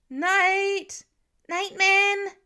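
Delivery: high, loud desperate shout; frantic father calling. A narrator's reading. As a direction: yell his name into the woods. Father calling lost son.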